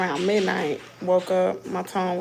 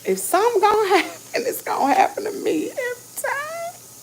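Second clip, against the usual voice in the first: high, loud voice breaking into tears; real tearful outburst at the dentist; about to cry; 14 semitones higher, 7 dB louder than usual. Real, not acted. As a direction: let it crack into real crying. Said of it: "I feel like I'm about to cry".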